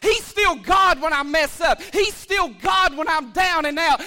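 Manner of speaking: loud, ringing preacher's proclamation; full-force preaching. Acted. Pastor preaching at full force to the congregation. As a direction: preach it out to the whole church.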